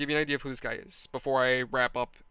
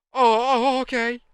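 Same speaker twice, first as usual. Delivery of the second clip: sudden realization; 7 semitones higher, 7 dB louder, slower than usual. Retold or imitated. Retold friend's sudden understanding.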